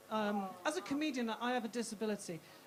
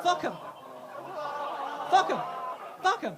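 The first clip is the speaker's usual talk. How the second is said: defiant shout; 2 semitones higher, 6 dB louder than usual. Acted. Comic dismissing the children in hospice, defiant shout. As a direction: throw it out as a loud dismissal.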